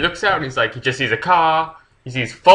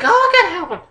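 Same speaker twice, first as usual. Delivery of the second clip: much higher mock frantic voice; hammed-up panicked wife; much faster than usual. Wife rushes to save her husband, high mock panic. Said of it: "the wife is like".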